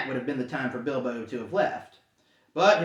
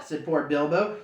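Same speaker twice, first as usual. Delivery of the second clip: plain reading voice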